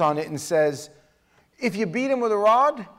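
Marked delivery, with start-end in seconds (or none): 1.6-2.9 higher, louder mock-serious quote; sarcastic. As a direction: deliver it with a sarcastic wink. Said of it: "sarcastic comedy".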